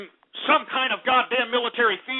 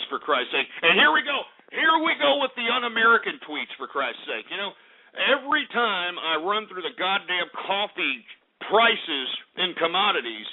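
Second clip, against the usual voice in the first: ordinary delivery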